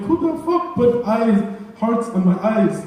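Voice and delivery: high, loud, slow mock outrage at his own notes; baffled outburst. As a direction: demand it like someone vandalised your notebook. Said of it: "I'm like".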